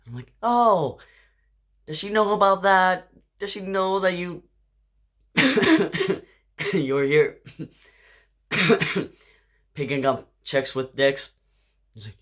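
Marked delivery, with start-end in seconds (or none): 0.4-11.3 higher and louder, full diva indignation; sassy mock-shocked question. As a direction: call him out with theatrical outrage. She mockingly confronts him.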